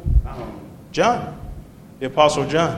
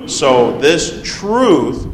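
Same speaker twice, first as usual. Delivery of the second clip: truth drawn out very slowly for weight; stress on truth; 3 dB louder, much slower than usual. Real, not acted. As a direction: lean slowly and firmly on "truth". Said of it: "I'm gonna emphasize that".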